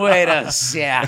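very drawn-out mock double take; acting out slow-dawning suspicion; slow realization. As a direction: stretch each word as the penny drops. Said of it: "It took me a second".

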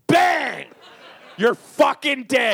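loud, high burst, then drawn out; mock gunfight shout. Host acting out the Deadwood murder, shouting. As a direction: shout it like a showdown kill.

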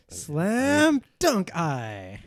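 hugely drawn-out words; slow-motion sports-move callout; triumphant dunk call. Acted. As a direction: stretch each word as if soaring to the hoop. Triumphant call while dunking the eye.

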